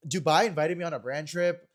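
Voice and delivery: ordinary talk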